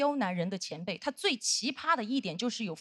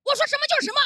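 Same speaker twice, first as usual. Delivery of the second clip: imitating a fierce, loud, high-pitched outburst; vicious command; 13 semitones higher, 6 dB louder, much faster than usual. Senior colleague pulls narrator aside, vicious shouted command. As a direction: lay down the law, shouting. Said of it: "恶狠狠地说".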